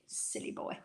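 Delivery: very soft, voiceless aside; whispered teasing scold. Pretend. Speaker chiding Declan over his geography, whispered mock scold. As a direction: whisper a playful telling-off under your breath.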